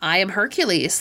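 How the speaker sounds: usual speaking voice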